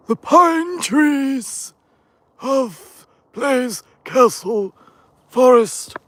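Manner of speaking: hammed-up epic narrator; mock grand announcer. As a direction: announce it like a film trailer title. Grandly announcing the forest name.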